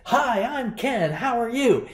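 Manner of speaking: high, bright-eyed server patter; chirpy American waiter. Retold or imitated. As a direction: greet them like an eager waiter working the table. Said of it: "some guy who walks up to you and say".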